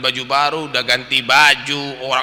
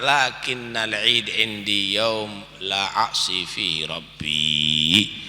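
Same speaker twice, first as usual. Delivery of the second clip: slow, low, soft recitation; Arabic recitation; 5 semitones lower, 5 dB quieter, much slower than usual. Acted. Preacher quoting Ali in Arabic, slow and hushed. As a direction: intone the Arabic saying slowly and reverently.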